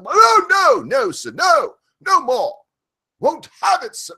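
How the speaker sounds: very high hammed-up British refusal; fussy Brit saying no no no; British accent. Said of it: "I can hear the British right now".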